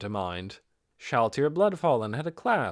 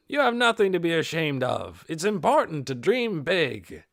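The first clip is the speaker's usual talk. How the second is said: louder, booming mock encouragement; hearty teasing; 5 semitones higher, 5 dB louder than usual. Dine ribbing embarrassed Ninya, loud hearty mock praise.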